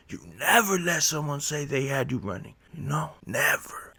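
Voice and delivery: high, intense lecture with a hushed, half-whispered "You never run from nobody"; stern uncle. Retold or imitated. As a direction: press the kid hard, dropping to near whisper. Uncle lecturing young nephew.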